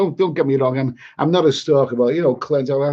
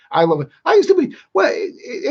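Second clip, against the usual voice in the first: ordinary talk, nothing marked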